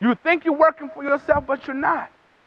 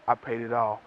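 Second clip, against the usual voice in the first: much lower and softer, solemn and steady; quiet pleading advocate; 13 semitones lower, 10 dB quieter than usual. Acted. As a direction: say it low like a final verdict of love. Advocate pleading before God.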